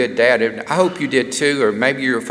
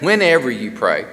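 usual speaking voice

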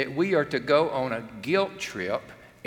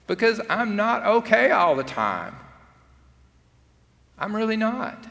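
higher and louder, pleading honesty; earnest confession; 8 semitones higher, 4 dB louder than usual. Real, not acted. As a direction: press the admission out hard. Confessing struggle from pulpit.

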